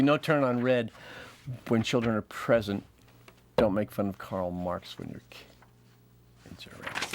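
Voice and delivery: بطء ساخر; slow punchline delivery. Shown as a demonstration. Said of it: "Wait for it".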